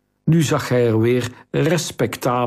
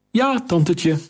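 put-on higher, grinning young boy's voice; a lightly voiced cocky lad; cheeky boy; 7 semitones higher than usual. A narrator's reading. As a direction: play the brash nephew greeting his aunt.

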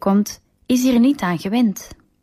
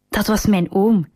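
usual speaking voice

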